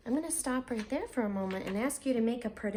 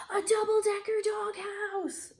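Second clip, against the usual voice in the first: excited cry; 9 semitones higher than usual. Acted. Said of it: "cried the brothers".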